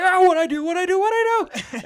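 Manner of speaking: frantic high-pitched panic; freaked out. Retold or imitated. Rookie alien after ripping out the teeth, panicking high and frantic. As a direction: babble it like a rookie who broke something. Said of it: "freaked out".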